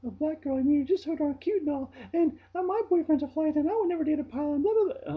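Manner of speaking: high, loud gushing ditzy voice; mimicking coworker. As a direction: gush it like a clueless airhead. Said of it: "She was saying".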